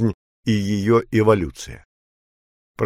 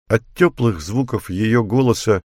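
plain reading voice

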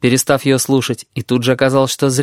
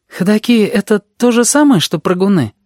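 higher-pitched, careful asking; cautious question; 9 semitones higher, 3 dB louder than usual. A narrator's reading. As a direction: ask warily, afraid of slipping up. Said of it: "осторожно спросил он Рыжую".

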